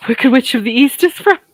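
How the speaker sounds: laughing through the words, loud and high. Real, not acted. Joking to viewers about stepmom's hometown, laughing. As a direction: crack up loudly at your own joke.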